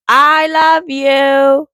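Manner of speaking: slow and stretched, tender sign-off; lingering affectionate goodbye; drawn-out love. Real, not acted. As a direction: say it fondly, slowly.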